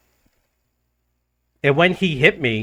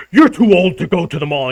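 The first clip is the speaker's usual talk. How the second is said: higher, louder mock yell; mock angry yell; 5 semitones higher, 7 dB louder than usual. The angry guy scolding him about the mall, mock yell. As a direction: bark it at him like the angry drunk.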